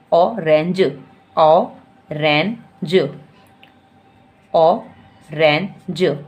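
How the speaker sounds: letter by letter, then the word drawn out; spelling out slowly. Shown as a demonstration. Teacher spelling orange for a child, slow and drawn out. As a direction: sound out each chunk slowly for a child. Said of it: "इसी तरह जैसे मैं आपको बता रही हूँ".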